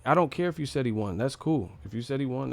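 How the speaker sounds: ordinary delivery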